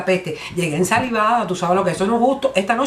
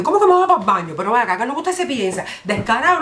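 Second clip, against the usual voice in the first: high-pitched offended outburst; mock indignation; 4 semitones higher than usual. Woman rebuffing man's bathroom invitation, acted indignation. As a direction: scold him in rising disbelief.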